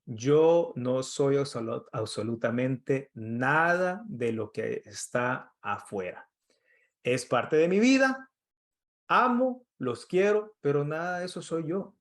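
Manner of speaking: slow, deliberate prayer for the listeners to repeat; measured recited prayer; slow recitation. Shown as a demonstration. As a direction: lead the phrase slowly so they can follow. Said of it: "puede repetir conmigo esta, eh, esta oración".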